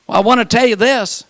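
usual speaking voice

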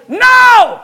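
high, loud shouted "No!"; loud refusal. Acted. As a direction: shout it like slamming a door. Refusing temptation, acted out.

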